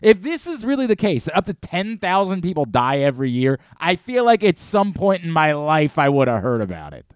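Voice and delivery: conspiracy rant. Acted.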